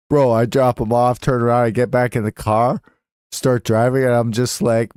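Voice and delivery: usual speaking voice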